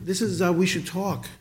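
ordinary delivery

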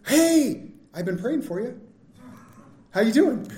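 a bright, high Hey tossed off casually; breezy greeting. Retold or imitated. Pastor mocking churchgoers greeting someone they forgot, breezy. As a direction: greet them like nothing's wrong. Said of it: "they're just like".

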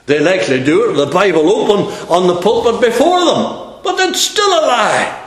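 loud, high, ringing pulpit preaching; preaching at full force. Acted. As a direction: hammer it home to the congregation.

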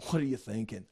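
low, muted scoff; acted disdain, kept under the breath; indignant snort. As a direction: mutter it in mock indignation. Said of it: "with indignation".

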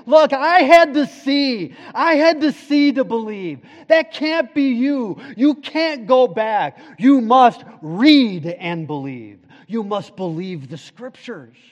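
loud, high impassioned preaching, easing at the end; preaching at full force. Acted. Pastor pressing the congregation to believe, fervent preaching. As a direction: drive each line home from the pulpit.

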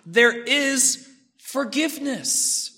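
slow, reverent reading of the verse; tender psalm quote. Acted. Preacher quoting the psalmist, in slow reverent awe. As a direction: let it land slowly with awe. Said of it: "He says".

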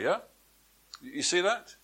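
usual speaking voice

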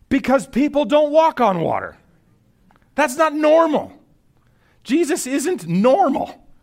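high, loud, incredulous preaching; emphatic exclamation. Real, not acted. Preacher explains why the disciples feared, loud emphatic exclamation. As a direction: cry it out as if stating the obvious.

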